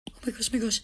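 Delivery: normal talking voice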